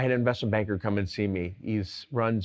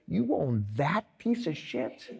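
incredulous blurt; 4 semitones higher, 4 dB quieter, slower than usual. Retold or imitated. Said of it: "And I go".

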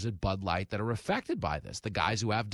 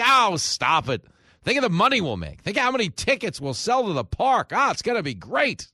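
greedy tech execs; 11 semitones higher, 6 dB louder than usual. Retold or imitated. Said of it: "Google and Microsoft are like".

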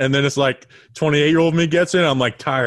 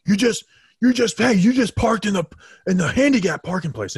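berating stranger; 7 semitones higher than usual. Retold or imitated. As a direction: imitate an angry guy scolding a woman. Guy berating woman, retold.

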